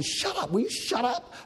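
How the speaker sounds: high, clipped hush of an annoyed crowd; mock the crowd telling him to hush. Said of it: "all the guys are saying".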